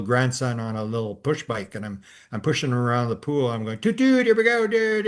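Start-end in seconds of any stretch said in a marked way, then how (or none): 3.8-5.1 put-on high, loud sing-out to a toddler; playful toot-toot. Grandpa pushing grandson's bike round the pool, playful. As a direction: play choo-choo for a little kid, pitched way up. Playing train with little grandson.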